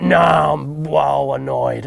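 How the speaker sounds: drawn-out, slow complaint; slow grumpy bailiff. Retold or imitated. Bailiff complaining to the angler, slow and put-upon. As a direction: stretch it out like a sulking bailiff.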